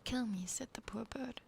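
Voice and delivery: ordinary delivery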